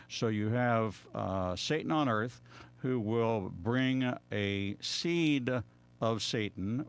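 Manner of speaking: ordinary talk, nothing marked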